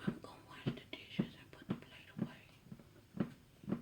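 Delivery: secret whisper to the viewers. Real, not acted. Wife whispering to viewers so husband won't hear. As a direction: lean in and whisper it unvoiced.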